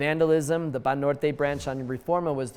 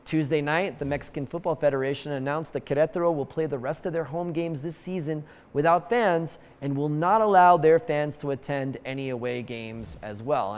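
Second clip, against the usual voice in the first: usual speaking voice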